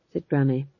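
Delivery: plain reading voice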